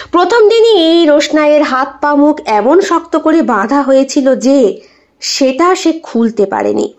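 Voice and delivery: usual speaking voice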